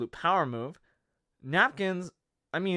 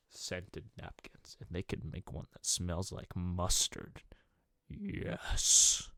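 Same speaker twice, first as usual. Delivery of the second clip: slow, lower breathy whisper like a luxury ad; hushed perfume-commercial whisper; mock sultry whisper; 9 semitones lower, 7 dB quieter, slower than usual. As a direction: purr the product name seductively. Mock seductive ad for scented napkins.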